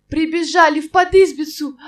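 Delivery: ordinary delivery, nothing marked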